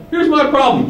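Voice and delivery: lightly acted captain, pitched high; captain reporting. Captain addressing the general, acted.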